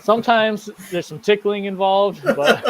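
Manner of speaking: cracking up while joking; laughing. Real, not acted. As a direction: giggle out the joke. Joking through shared laughter.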